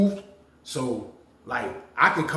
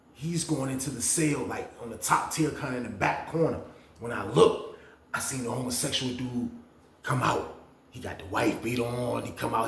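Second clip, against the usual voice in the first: normal talking voice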